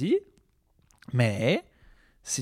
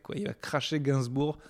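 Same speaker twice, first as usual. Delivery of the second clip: normal talking voice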